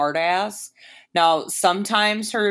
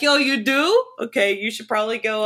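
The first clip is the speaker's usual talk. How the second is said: exasperated scolding; 6 semitones higher, 5 dB louder than usual. Retold or imitated. Scolding believers after ghost scare.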